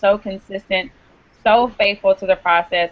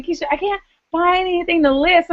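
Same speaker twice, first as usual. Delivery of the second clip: higher-pitched mimicked complaint; mimicked complainer; 9 semitones higher than usual. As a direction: imitate someone complaining they are stuck. Mimics people complaining to her.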